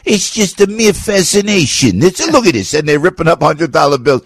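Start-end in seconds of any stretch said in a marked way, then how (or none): 0.1-2.7 mobster quote. Retold or imitated. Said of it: "They go".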